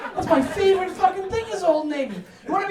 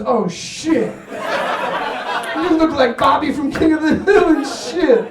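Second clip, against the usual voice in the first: mocking barber; 5 dB louder than usual. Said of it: "he goes".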